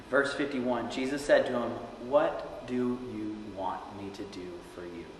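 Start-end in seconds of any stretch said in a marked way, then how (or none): 2.1-5.0 hushed, unhurried scripture reading; gentle question. A narrator's reading. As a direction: ask tenderly, word by word.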